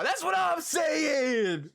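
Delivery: building to a high, drawn-out shout; indignant agreement. Real, not acted. Streamer agreeing with a viewer about the clips, indignant shout. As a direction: cry out in vindication, stretching it high.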